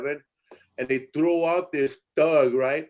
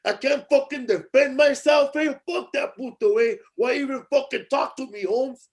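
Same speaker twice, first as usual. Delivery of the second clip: thug ranting; 9 semitones higher than usual. Retold or imitated. Thug shouting while being kicked out. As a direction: keep barking it up high. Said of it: "kept telling".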